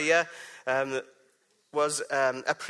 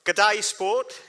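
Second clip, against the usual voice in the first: a quick hammed-up Australian hello, higher and louder; mock Australian greeting; 5 semitones higher, 6 dB louder than usual. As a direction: throw in a cheeky fake Australian "G'day". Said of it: "I can't do a Canadian accent".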